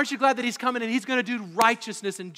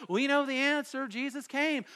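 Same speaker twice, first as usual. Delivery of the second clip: put-on snarky kid; 6 semitones higher than usual. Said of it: "my snarky little ten-year-old head would go".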